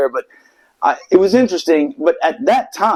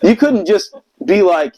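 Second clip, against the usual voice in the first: ordinary talk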